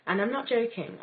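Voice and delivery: ordinary talk, nothing marked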